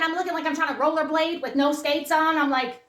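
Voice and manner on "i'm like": ordinary talk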